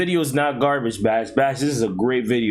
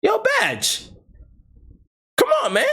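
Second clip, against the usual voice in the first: excited outburst. Real, not acted. Calling out to streamers, indignant.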